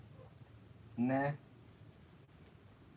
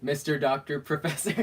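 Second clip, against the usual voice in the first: laughing; 3 semitones higher, 3 dB louder than usual. Real, not acted. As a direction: joke title breaking into a laugh. Joking title, breaking into laughter.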